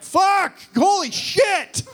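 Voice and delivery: high, loud, slow hammed-up delight; mock excited yelling. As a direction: shout with giddy joy, laughing. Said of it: "everyone was like".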